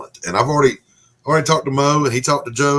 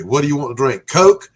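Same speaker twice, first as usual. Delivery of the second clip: drive-thru clerk; 8 semitones higher, 10 dB louder than usual. Retold or imitated. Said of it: "they would say".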